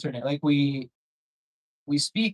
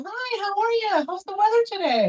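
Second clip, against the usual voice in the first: very high, chirpy mock greeting; overly nice stranger; 3 dB louder than usual. Nice stranger to him on the street at seven AM, chirpy mock greeting. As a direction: squeal a too-friendly hello in falsetto.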